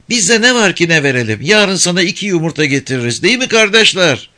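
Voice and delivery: put-on high, lively little village girl; kız çocuğu sesi. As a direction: play a cheeky young girl. Girl bargains. (tiz sesli köylü kız)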